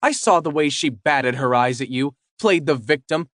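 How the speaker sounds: put-on high, agitated girlfriend; upset confrontation. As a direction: demand answers, hurt and angry.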